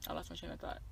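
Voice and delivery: trailing off into a breathy mumble; barely voiced. Real, not acted. Loses her thread mid-story.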